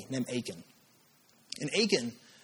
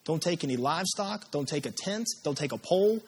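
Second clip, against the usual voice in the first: normal talking voice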